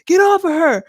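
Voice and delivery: high, loud yell of get off her; imitated yell from a bathroom brawl; retold shout. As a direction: shout it like a fight breaking out. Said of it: "all you heard was".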